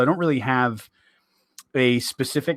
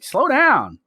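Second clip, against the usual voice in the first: high-pitched Southern drawl; Southern complaint; 8 semitones higher, 4 dB louder than usual. Retold or imitated. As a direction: mimic a Southerner protesting the pace. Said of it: "down south and people would be like".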